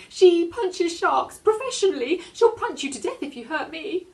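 put-on a little girl bluffing bravely; girl threatening the shark with her mum; young girl. A narrator's reading. Reader voices the girl.

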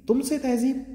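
sharply high, indignant question; scornful retort. Acted. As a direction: spit back the word in disbelief. (तिरस्कार भरा सवाल)

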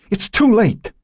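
very high, piercing scream. Acted. Joan screaming at her sister that it's too late. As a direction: shriek the words with hatred. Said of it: "she screamed".

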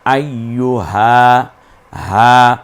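drawn-out হা; long a. Shown as a demonstration. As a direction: hold the a of হা one count. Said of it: "এক আলিফ টেনে বলতাম".

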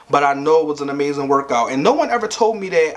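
ordinary talk, nothing marked

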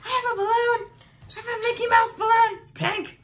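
put-on high, loud squealing kid voice; little kid thrilled with a pink balloon; excited child. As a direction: shriek with delight over the balloon. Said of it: "I get all excited".

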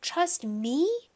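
high, incredulous echo; offended disbelief, rising; indignant. A narrator's reading. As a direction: throw the accusation back as a question. Boy indignant at blame.

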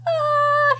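high frightened cry; imitating a scared dinosaur; mock scared "ah". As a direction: let out a high "ah" of pretend fright. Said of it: "face that Tyrannosaurus is making".

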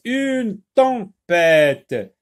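"tempête" stretched out loudly as tem-pête; very slow, loud "une tempête" with gaps; syllable by syllable. Shown as a demonstration. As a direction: sound out "tempête" for beginning readers. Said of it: "trois syllabes".

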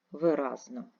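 ordinary talk, nothing marked

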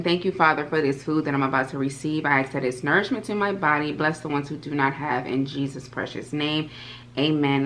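softening, quiet prayer before eating; bow your head and say grace quietly; mealtime blessing. Real, not acted. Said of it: "let me say my blessing".

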